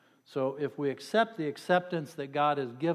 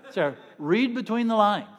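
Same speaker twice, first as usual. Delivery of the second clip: louder and higher, laughing through it; loud laughing punchline; 7 semitones higher, 10 dB louder than usual. Real, not acted. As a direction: push it out over the laughter. Punchline after crowd laughs.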